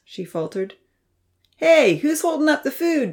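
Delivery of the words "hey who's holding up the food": high, loud impatient call. A narrator's reading. Damien hungry and waiting, loud call. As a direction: shout across to hurry the food along. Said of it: "Damien called out".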